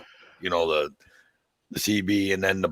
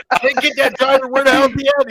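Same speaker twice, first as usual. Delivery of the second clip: mock confused trucker; 6 dB louder than usual.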